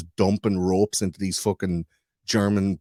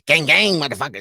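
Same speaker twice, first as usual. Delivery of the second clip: higher, louder mock gangster swagger; mocking flashy rich guy; 6 semitones higher, 6 dB louder than usual. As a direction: ham up a bling-wearing show-off. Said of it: "with some fucking diamonds in his teeth going".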